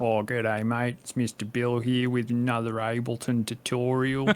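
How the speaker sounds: hammed-up broad Australian YouTube tutorial voice; broad bogan Australian. Host mocking his old tutorials in broad Australian accent. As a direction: lay the ocker Australian on thick, mocking his old self. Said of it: "I sound like a fucking bogan".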